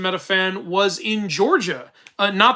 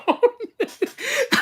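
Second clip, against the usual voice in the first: high burst of laughter. Real, not acted. Laughs at the phone reveal.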